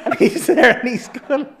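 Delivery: high laughing telling; high, loud laughter while telling of the fallen body. Real, not acted. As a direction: laugh helplessly through it. Body falls, teller laughing.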